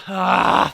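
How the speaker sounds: loud, exaggerated sigh; exasperated sigh. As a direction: imitate bosses heaving a big sigh. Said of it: "they sigh".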